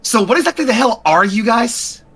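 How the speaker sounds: high, incredulous demand. Acted. Human woman confronting the aliens, high and incredulous. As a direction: push the question up high.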